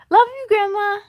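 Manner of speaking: high, loud call after her; boy calling to departing grandma, shouted goodbye. A narrator's reading. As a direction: call out across the yard. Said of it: "shouted B J".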